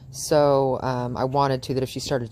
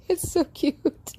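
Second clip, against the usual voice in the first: cooing; much slower than usual. Real, not acted. Cooing over the dog.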